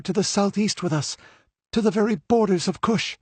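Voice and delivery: put-on high young woman's voice, rushing at first; hurrying through her story before she is missed; hurried telling. A narrator's reading. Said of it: "she continued hurriedly".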